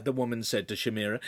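ordinary delivery, nothing marked

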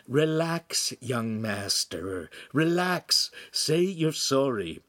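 slow, hushed counsel; soothing Pan. Acted. Secret helper advises choked apprentice, slow and soothing. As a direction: coax slowly, lifting the pitch on key words.

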